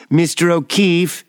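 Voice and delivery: put-on high, lightly accented Chinese voice. A narrator's reading. Nurse calling from behind the curtain, Chinese accent. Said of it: "a voice with a Chinese accent".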